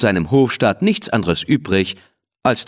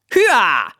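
shout; 10 semitones higher than usual. A narrator's reading. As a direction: yell it to spur the horse on. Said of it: "schrie er".

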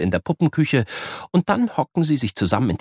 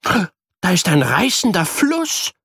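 put-on slow, higher boy calling; 10 semitones higher, 3 dB louder, slower than usual. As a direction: point and call out. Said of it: "ruft Luca".

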